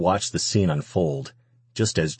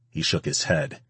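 ordinary delivery, nothing marked

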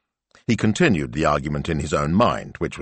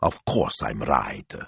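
low, slow self-satisfied reply; smug satisfaction; 7 semitones lower, 4 dB quieter, slower than usual. A narrator's reading. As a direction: settle back smugly, taking your time. Self-satisfied reply to imagined wife.

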